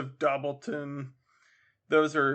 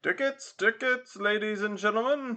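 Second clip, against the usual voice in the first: high, carrying steamboat call; ticket man calling; 7 semitones higher than usual. Acted. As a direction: call for tickets over a crowd. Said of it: "said the man in the paddle box".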